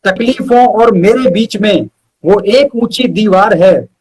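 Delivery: measured, rhythmic recital with pauses between phrases; unhurried poetry reading, each line landing on its end; poem recitation. Acted. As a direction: recite it like a heartfelt ode to your best friend. Recites his friendship poem.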